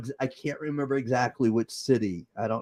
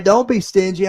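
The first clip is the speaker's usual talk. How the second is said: Don't be stingy, punched higher and louder at normal pace; louder repeat; 6 semitones higher, 7 dB louder than usual. Real, not acted. Host repeats his demand to viewers, louder and higher. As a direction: snap it out as a firm repeat.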